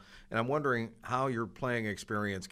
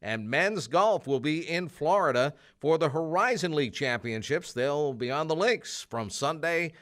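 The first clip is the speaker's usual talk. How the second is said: lively, higher radio rundown, a touch louder; sports announcer; 4 semitones higher, 6 dB louder than usual. Acted. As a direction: run through the weekend scores like a campus DJ. Host reads sports rundown.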